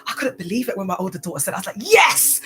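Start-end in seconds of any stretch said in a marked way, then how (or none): 1.8-2.4 much higher, louder cheer; thrilled yes. Retold or imitated. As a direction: burst out with delight. Mum thrilled at daughter's words.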